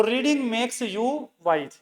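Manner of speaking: very slow dictation pace. Shown as a demonstration. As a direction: say each word slowly for writing. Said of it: "तो easy हो गया".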